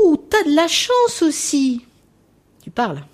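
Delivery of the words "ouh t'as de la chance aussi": high, louder, slow "ouh" of wonder; mock teacher delight. Preschool teacher admiring a child's sneakers, slow high coo.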